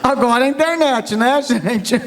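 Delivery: chuckling through the words; laughing. Real, not acted. Laughing to the audience about the sound truck. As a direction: laugh it out as a joke.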